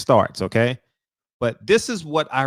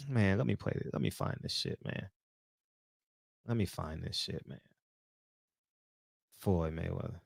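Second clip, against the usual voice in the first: much softer, under his breath; muttering to himself; 6 semitones lower, 17 dB quieter than usual. Real, not acted. Streamer hunting for a clip, muttered aside. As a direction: mumble it as you search.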